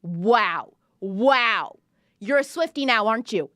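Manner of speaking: ordinary talk, nothing marked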